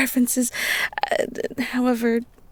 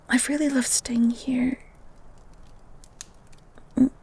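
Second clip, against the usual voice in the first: tender whisper. Acted. Girl confesses her love to the beast, tenderly whispered.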